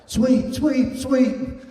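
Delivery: high shouted alarm call; hollering. Retold or imitated. Teammate Matt yelling the play at the sideline, loud and high. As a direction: yell the warning across the field. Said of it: "Matt's hollering".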